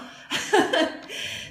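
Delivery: airy, high laugh with no voice; high breathless laugh. Real, not acted. Host laughing at her own joke with viewers, airy giggle.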